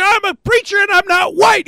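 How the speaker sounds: high-pitched heated insistence; acted angry retort. Preacher answering the doubting crowd, heated retort. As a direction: insist at the top of your range.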